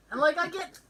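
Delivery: ordinary talk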